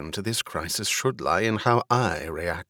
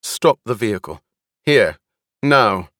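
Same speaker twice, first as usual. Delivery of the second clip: firm command in short bursts, louder on the last words; raised-voice order to a driver; 7 dB louder than usual. A narrator's reading. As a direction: order the driver, building in volume. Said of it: "raised her voice to the driver".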